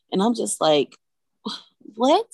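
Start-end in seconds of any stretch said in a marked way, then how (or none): 2.0-2.3 shocked what. Retold or imitated. Shocked hearing baby's seizure news.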